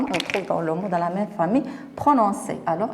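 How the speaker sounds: ordinary talk, nothing marked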